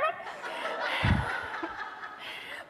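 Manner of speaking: breathy high giggle; laughing. Real, not acted. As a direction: break into giggles. Reader laughs mid-story.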